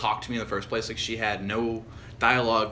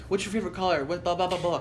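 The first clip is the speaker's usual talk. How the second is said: higher, mock-chirpy questioning; mocking interview questions; 5 semitones higher than usual. Guy parodies the small-talk questions he hates, mocking. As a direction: reel off a higher-pitched mock question, trailing into blahs.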